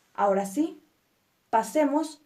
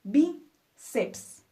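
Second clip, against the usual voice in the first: "Bíceps" split, higher stressed BÍ, then ceps; stressed penultimate syllable; 4 semitones higher than usual. Shown as a demonstration. Teacher accenting the penultimate syllable, "Bíceps" split with BÍ stressed. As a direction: lift BÍ in "Bíceps", pause, drop on ceps. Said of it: "acentuamos nuestra penúltima sílaba".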